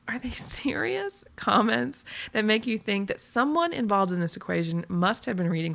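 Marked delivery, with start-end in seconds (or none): 0.1-1.2 high, slow disbelief; incredulous question. Acted. Blogger reacting to misreading comments, incredulous question. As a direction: ask it in drawn-out, high disbelief.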